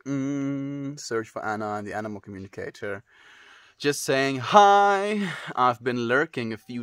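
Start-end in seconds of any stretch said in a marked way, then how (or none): 3.8-5.3 drawn-out higher greeting; slow sing-song. Acted. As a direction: stretch it out brightly. Reading chat greeting drawn out.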